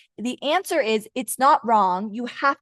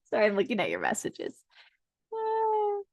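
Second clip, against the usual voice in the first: ordinary delivery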